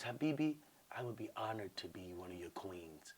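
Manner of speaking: hushed, falling low and tender; soft-spoken woman. Retold or imitated. Woman offering to be one of his queens, real soft. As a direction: offer it very softly. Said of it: "Real soft".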